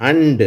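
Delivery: emphatic English demo word and; model word and. Shown as a demonstration. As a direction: model and clearly for students. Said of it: "அடுத்தது".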